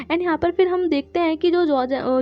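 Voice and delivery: ordinary delivery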